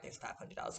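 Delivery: hushed. Real, not acted. Friend describes finding money in her account, hushed reveal. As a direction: drop to a hush.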